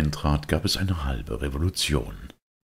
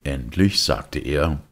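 usual speaking voice

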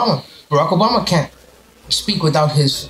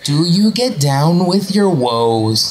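exaggerated drawn-out narrator cadence; mock a countdown narrator, stretching the phrase endings; imitating Chills's narration; slower than usual. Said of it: "nobody in Canada talks".

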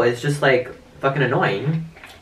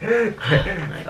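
laughing disbelief; 7 semitones higher, slower than usual. Real, not acted. Laughing shock at prank.

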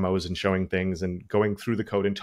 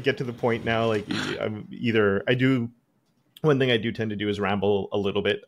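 ordinary talk, nothing marked

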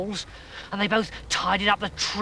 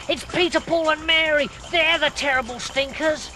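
excited high voice; 10 semitones higher than usual. Acted. Ernie reports the stinkers.

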